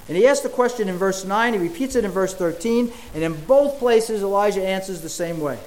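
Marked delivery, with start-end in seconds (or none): none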